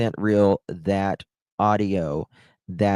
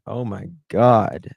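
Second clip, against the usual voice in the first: laughing. Real, not acted. Still laughing.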